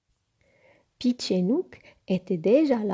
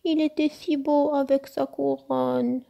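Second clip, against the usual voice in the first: high little lamenting voice; high storybook plea; plaintive protest; 6 semitones higher than usual. A narrator's reading. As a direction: protest wistfully, pitched up. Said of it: "protesta Peach".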